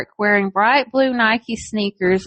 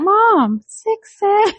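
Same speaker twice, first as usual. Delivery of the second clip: put-on excited kid voice; 9 semitones higher, slower than usual. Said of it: "He's like".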